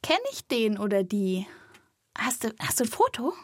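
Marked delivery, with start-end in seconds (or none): none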